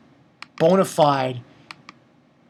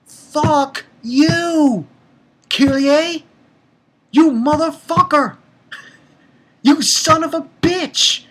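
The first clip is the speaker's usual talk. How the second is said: furious yell; 10 semitones higher, 4 dB louder, slower than usual. Retold or imitated. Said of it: "And I'm like".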